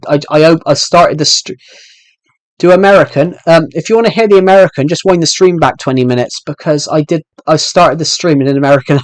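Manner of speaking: ordinary talk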